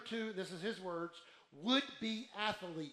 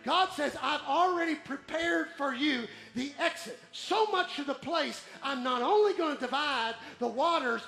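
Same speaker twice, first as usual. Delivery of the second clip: loud, high, driving sermon voice; impassioned pulpit delivery; full-force preaching; 6 semitones higher, 9 dB louder than usual. Real, not acted. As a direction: preach it hard, building to a shouted climax.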